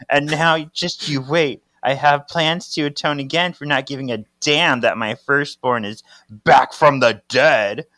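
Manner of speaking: acted indignant rant, punching key words; angry accusation. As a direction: hurl it as a mounting reproach. Mother's angry accusation, read aloud.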